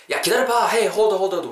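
high-pitched, acted excited call to wait; urgent hold-on. Shopper stops companion at the favorite store, acted urgency. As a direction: play stopping a friend in excitement. Acting out spotting a shop.

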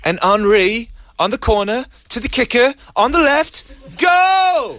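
rising excited British commentary; English football commentator. Pretend. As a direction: build to a shouted go like a match caller. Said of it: "do the Detective Wellington voice".

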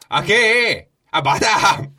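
high, loud barker's call; tout role. Acted. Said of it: "삐끼예요".

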